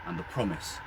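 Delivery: plain reading voice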